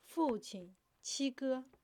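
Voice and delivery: loud, narrated cry of grief; anguished cry. A narrator's reading. Girl learns father and brother died, anguished cry. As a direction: call out for them in anguish. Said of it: "玉清发出了一声撕心裂肺的哭喊".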